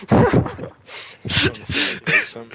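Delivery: high, slow, breathy goofy voice; slow mock tough-guy wheeze; mocking Kyle. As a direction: ham up a dim brute. Imitating Kyle.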